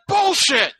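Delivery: high-pitched indignant outburst; pissed-off Joe Biden. Retold or imitated. [name] imitating senator asked about Bush's remark, pissed off. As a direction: burst out with it, mock-furious. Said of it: "you could tell he was so pissed".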